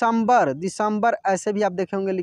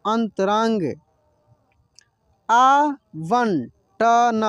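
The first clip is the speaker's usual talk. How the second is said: आवंटन drawn out slowly, each syllable clear; slow syllable reading. Shown as a demonstration. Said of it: "जैसे जैसे मैं पढ़ रहा हूँ ना". (आवंटन को धीरे धीरे, हर अक्षर साफ़ बोलिए)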